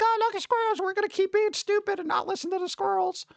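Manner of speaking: high-pitched mock voice of a clueless public; mocking society. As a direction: lampoon careless people in a silly high voice. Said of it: "You were like".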